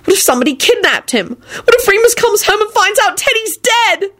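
high, tearful panic; high-pitched frantic lament; wailing. A narrator's reading. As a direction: wail through worst fears, building. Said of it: "she wailed".

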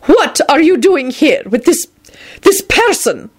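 high, stern headmistress voice; stern disapproval. A narrator's reading. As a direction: question him disapprovingly, halting before the last words. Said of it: "she intoned sternly".

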